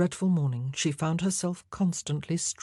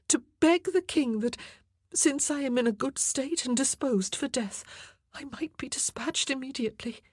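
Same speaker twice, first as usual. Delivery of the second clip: much higher, urgent entreating voice; higher, tearful plea of a condemned queen; pleading queen; 9 semitones higher than usual. A narrator's reading. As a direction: beg the king through him, voice rising. Anne pleads to die sooner.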